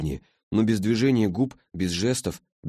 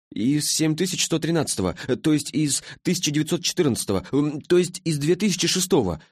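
put-on muddled boy correcting himself; high, stumbling self-corrections with filler sounds; flustered; 9 semitones higher than usual. A narrator's reading. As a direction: get tangled up and keep restarting. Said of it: "запутался Ластик".

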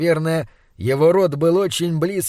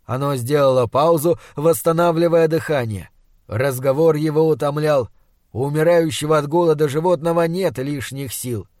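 ordinary delivery, nothing marked

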